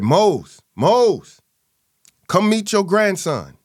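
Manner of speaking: loud, high call across the house. Retold or imitated. Grandma calls her husband to meet grandson, loud call. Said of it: "she said".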